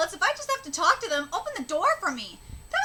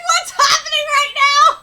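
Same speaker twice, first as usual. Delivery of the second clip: high, loud, panicked cry; panicked shout; 9 semitones higher, 10 dB louder than usual. Retold or imitated. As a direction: yell it in total confusion. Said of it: "Kiyo's like".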